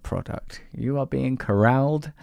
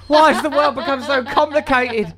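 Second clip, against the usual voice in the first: much higher and louder, hammed-up despairing voice; mock exasperated rant; 6 dB louder than usual. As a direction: wail it like a fed-up prophet.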